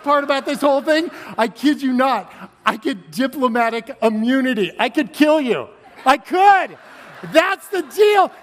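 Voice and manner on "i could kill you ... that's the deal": higher and louder, laughing, ending slow and shouted; gleeful mock-menacing shout; excited joking shout. Real, not acted. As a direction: play the outrageous joke big. Joking about diplomatic immunity.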